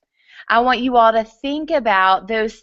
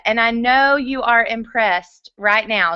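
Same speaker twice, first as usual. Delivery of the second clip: ordinary delivery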